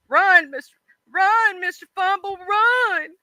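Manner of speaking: high, drawn-out cry of warning; mock urgent calling. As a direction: call out as if urging him to flee.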